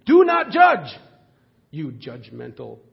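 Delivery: hammed-up jab at the preacher; mocking judgmental voice. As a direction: throw "Do not judge" back as a sneering accusation. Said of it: "they say this very judgingly".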